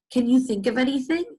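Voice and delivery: normal talking voice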